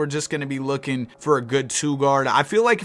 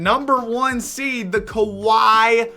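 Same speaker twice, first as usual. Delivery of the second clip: excited; 6 semitones higher, 3 dB louder, slower than usual. Real, not acted. Cheering the number one seed.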